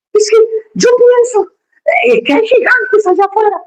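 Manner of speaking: put-on high, whimpering child's excuse; hammed-up high child whine; whiny son. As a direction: play a scared whining kid. Whining son in an acted dialogue.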